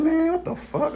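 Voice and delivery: high disbelieving cry at himself; mock exasperated squeal; exasperated outburst. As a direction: throw the pitch way up in frustration. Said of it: "I'm like".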